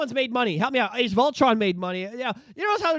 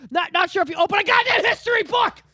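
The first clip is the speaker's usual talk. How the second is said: exasperated shout; 9 semitones higher than usual. Real, not acted. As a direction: yell it in disbelief. Ranting at Disney's logic.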